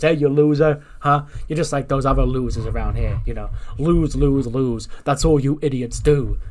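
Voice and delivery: loud, punchy insults in a retold rant; mock berating. Wrestler berating his losing students, loud mock scolding.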